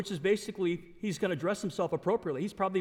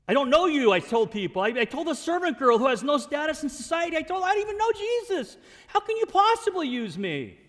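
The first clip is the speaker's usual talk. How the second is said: anguished, self-accusing outburst; Peter; 7 semitones higher, 6 dB louder than usual. Acted. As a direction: cry out high and loud in disbelief at being wanted. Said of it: "Peter's probably thinking".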